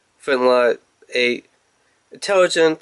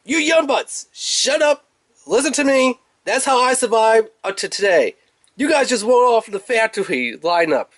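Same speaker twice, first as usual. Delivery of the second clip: much higher, hammed-up cranky old soldier; grumpy old veteran; 9 semitones higher than usual. Kup lecturing the young bots, a hammed-up high cranky veteran voice. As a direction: scold the rookies like a crusty sergeant. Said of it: "He's like".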